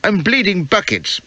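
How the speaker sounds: high, plaintive complaint; wounded man's protesting whine; pained groaning. A narrator's reading. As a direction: moan it high, feeling sorry for yourself. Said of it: "I groaned".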